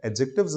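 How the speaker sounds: एडजेक्टिव्स with the d dropped; एडजेक्टिव्स spoken without the d; silent d. Shown as a demonstration. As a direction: say एडजेक्टिव्स as "ajectives", no d sound. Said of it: "डी वहाँ पर silent है".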